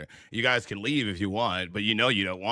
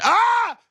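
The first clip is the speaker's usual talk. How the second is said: surprised yelp; 7 dB louder than usual. Real, not acted.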